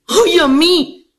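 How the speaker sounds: acted slow whine; whining wife. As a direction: play the put-upon wife. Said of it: "Yo le pregunto a la mujer".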